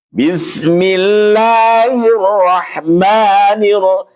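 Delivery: A'udzu billahi minasy syaithanir rajim slow but thin, not fully opened; slow chant with thin vowels; thin faulty recitation. Shown as a demonstration. Teacher giving an example of incomplete recitation. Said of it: "Ini kenapa? Karena tidak disempurnakan".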